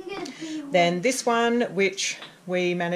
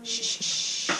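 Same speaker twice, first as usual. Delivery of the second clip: soft repeated shushing; 4 semitones higher, 5 dB quieter, slower than usual. Real, not acted. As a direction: hush them under your breath. Mum shushing kids.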